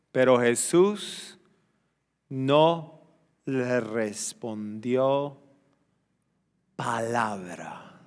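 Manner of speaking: slow and low, with long pauses; slow, solemn reading. Real, not acted. Preacher reading that Jesus gave no answer, slow and solemn. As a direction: let each word sink into silence.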